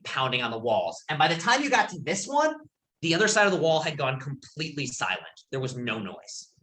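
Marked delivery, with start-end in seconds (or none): none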